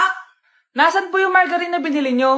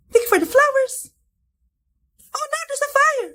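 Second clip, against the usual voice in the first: TikTok NPC live; 8 semitones higher than usual. Pretend. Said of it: "yung A I na trend sa TikTok".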